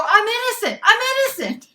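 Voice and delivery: high, very loud triumphant shout; strong declaration. Retold or imitated. Employee repeating her innocence to boss, loud high shout. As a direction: shout it out with full conviction. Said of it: "I said that really strong".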